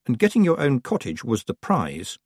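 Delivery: ordinary delivery, nothing marked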